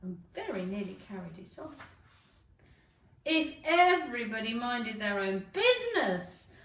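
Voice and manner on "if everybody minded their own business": loud, rough Duchess voice; a touch of the bossy Duchess; gruff Duchess. Acted. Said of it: "the Duchess said".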